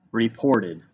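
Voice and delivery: ordinary talk, nothing marked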